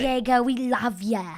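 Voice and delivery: wobbly mock British accent; botched British accent. Shown as a demonstration. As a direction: try Boston but land on a British accent.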